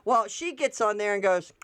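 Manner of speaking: usual speaking voice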